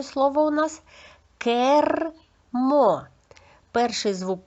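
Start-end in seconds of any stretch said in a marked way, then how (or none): 1.4-3.0 "кермо" split in two beats with a long trilled R; teacher slowly dissecting "кермо"; sound-by-sound demo. Shown as a demonstration. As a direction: stretch "кер", pause, then "мо" in "кермо". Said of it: "зробимо звуковий аналіз слів".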